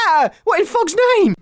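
high, alarmed squeal of surprise; startled shriek. Acted. Host finds a stranger in the room, startled high cry. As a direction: jump out of your skin.

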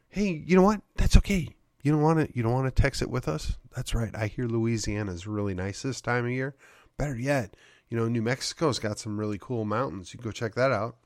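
quiet, dismissive mock send-off; a hushed sarcastic see-you-later to the doubters; sarcastic brush-off. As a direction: wave them off softly and sarcastically.

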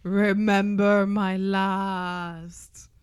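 very slow, measured wizard voice; calm Dumbledore. Acted. Co-host showing how they pictured the howler, calm Dumbledore. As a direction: intone it slowly and calmly, word by word. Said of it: "I picture the calm Dumbledore".

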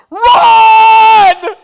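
sudden high panicked shout; yelled "Run!". Retold or imitated. Preacher recalling fleeing a bear, panicked shout. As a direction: bark it out like you're fleeing a bear. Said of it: "I thought".

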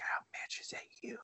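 mock hushed recognition, no voice at all; whisper. A karate friend spots him in disguise, whispering. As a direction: breathe it like a surprised secret.

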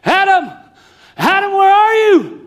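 high, loud call across the garden; father calling out. Acted. Preacher voicing God calling Adam in the garden, loud and high. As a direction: call for a lost son, ringing out. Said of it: "God's voice going through the garden".

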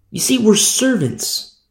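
ordinary talk, nothing marked

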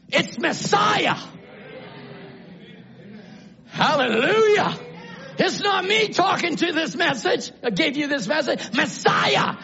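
shouted, high exultant preaching; preaching at full force. Real, not acted. Preacher rallying his congregation, shouted high exultation. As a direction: lift the congregation to shout praise.

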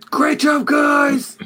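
loud, high triumphant shout to comrades; big, high-pitched cheer at the troops; yell. Acted. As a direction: yell congratulations across the battlefield. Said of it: "he yells as he sees his compatriots".

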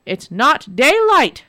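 slow, loud angry shout. Acted. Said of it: "shouted the Water Devil".

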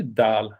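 Dal with a long, held ending; Dal, stretched at the end; drawn-out ending. Shown as a demonstration. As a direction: draw the end of Dal right out. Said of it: "man får dra ut lite på sista".

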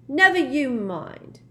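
high, loud, drawn-out snub; huffy rebuff. A narrator's reading. Myrtle to Ron and Harry, huffy. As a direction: shut them down huffily.

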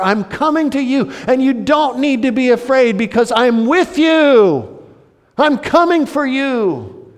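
loud, high proclamation of "I am"; full-force preaching. Acted. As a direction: proclaim God's name with power. Preaching Yahweh's name at full force.

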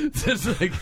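ordinary talk, nothing marked

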